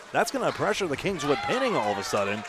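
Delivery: normal talking voice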